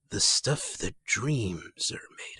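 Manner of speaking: slow, hushed whisper for the final line; slow, spooky whisper to end the story; dramatic whisper. Acted. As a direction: breathe it out slowly like a spell. Dramatic quote closing episode recap.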